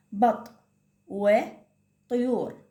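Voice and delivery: put-on slow teacher modelling for young children; slow drawn-out reading. Shown as a demonstration. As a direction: stretch وطيور out very slowly, sound by sound. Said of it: "هنا عندي حرف الطاء".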